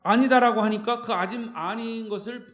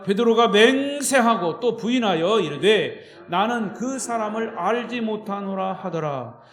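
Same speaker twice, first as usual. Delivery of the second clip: loud, declaimed Bible reading; scripture reading; 2 semitones higher, 6 dB louder than usual. Acted. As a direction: lead the congregation in reading the verse aloud. Reads scripture aloud with congregation. (힘주어 성경 봉독)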